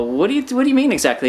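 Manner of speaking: high, fast, baffled asking; baffled question. Retold or imitated. Teller asking his buddy in disbelief, high and quick. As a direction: blurt it out, confused and fast. Narrator asks friend to explain.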